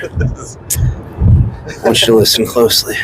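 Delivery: slow, hushed whisper. Pretend. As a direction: breathe it slowly into an ear. Mock seductive whisper to host.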